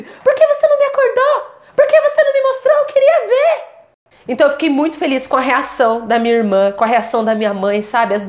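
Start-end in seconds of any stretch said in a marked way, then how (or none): 0.0-3.8 mock the upset sister complaining she wasn't woken; hammed-up sulky sister voice; angry sister. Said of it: "Ela simplesmente ficou brava".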